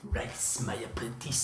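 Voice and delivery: whispered low sinister rasp; cold rasping evil emperor. Pretend. Repeats emperor quote.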